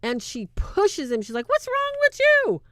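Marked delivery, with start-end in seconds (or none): 1.5-2.6 high, loud shriek; possessed woman shrieks at priest, much higher and louder; shrill yell. Retold or imitated. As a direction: scream it at him.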